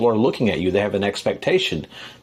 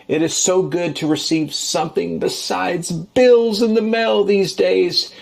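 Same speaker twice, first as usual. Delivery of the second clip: gushing delight; 7 semitones higher than usual. Real, not acted. Gushing over beer gift.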